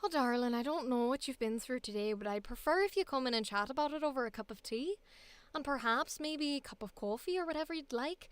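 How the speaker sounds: strong, feminized Irish accent. Shown as a demonstration. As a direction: coax them inside in a lilting Irish accent. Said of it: "because my Irish is".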